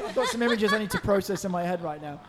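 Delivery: loud laugh breaking through words; laughing loudly. Real, not acted. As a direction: talk through a fit of laughter. Cracking up at the joke.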